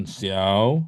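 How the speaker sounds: XIAO dips low and rises; low scooped XIAO; swung tone. Shown as a demonstration. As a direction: swing XIAO upward from a low start. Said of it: "Xiao-nya ayun".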